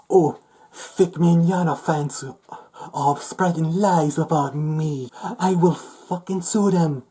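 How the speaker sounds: high, drawn-out whiny parody; mock outraged threat. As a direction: mimic an indignant poster, loud and high. Mocking a posted legal threat.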